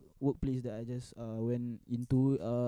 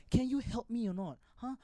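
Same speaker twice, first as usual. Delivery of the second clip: demanding customer; 6 semitones higher than usual. Retold or imitated. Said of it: "she went to my manager".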